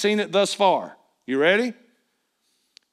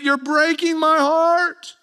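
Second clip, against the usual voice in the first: high, slow anguished cry; acted heartbroken protest; under pressure; 8 semitones higher, slower than usual. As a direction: draw it out high, pleading to be understood. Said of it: "Is [name] feeling the pressure here?".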